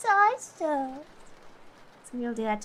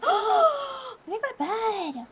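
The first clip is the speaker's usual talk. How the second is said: shocked realization; 11 semitones higher, much slower than usual. Real, not acted. Realizing it is almost five.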